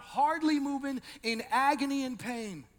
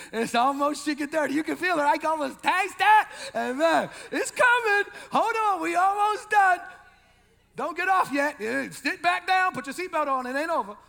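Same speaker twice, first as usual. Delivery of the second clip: loud, high call to the crowd; rally the congregation; playful shouting preacher; hyped preaching; 6 semitones higher, 7 dB louder than usual. Acted. Hyping up the congregation.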